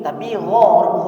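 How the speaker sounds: ordinary talk, nothing marked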